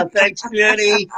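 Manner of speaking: laughing though it hurts the throat; pained laugh into the words; laughing through pain. Real, not acted. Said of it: "it hurts my throat when I laugh".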